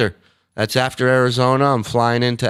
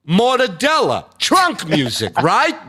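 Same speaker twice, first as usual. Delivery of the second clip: higher squeaky put-on voice; mock mobster voice; 9 semitones higher than usual. Riffing mob catchphrases in a high voice. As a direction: toss it off like a cartoon goon.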